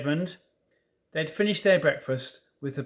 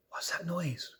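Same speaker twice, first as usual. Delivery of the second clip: 1 whispered fright; 10 dB quieter than usual. A narrator's reading. Lucy startled by a noise.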